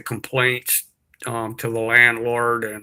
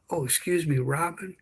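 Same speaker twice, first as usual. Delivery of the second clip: hushed; 5 semitones higher, 6 dB quieter than usual. Retold or imitated. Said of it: "And he started whispering".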